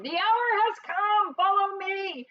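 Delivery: rally the comrades with a ringing cry; battle cry. Acted. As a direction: Badger bursts in to lead his friends, shouting. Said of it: "and cried".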